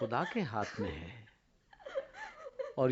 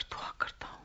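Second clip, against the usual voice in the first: pleading prayer. Acted. Prophet prays over a dead boy for life, pleading. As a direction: implore God, hushed between the invocations.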